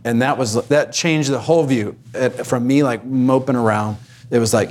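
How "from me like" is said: ordinary talk, nothing marked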